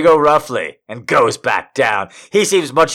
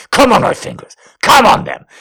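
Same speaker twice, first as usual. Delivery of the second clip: higher and louder, pressing; urgent demand; 11 semitones higher, 6 dB louder than usual. Acted. Lover demands she comes, loud urgent. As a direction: push her hard toward it.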